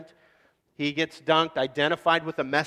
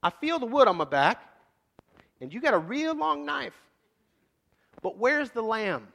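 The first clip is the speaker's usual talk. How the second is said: put-on much higher, lightly boyish voice, slowing on the last question; teenage son; 7 semitones higher than usual. Isaac asking his father about the lamb, boyish and curious. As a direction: play the sharp kid asking his dad innocently. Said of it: "He goes".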